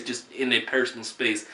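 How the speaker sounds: ordinary talk, nothing marked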